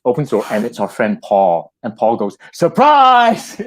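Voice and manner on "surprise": high, loud cheerful yell; surprise shout. Retold or imitated. Paul bursting through the doorway, loud high surprise.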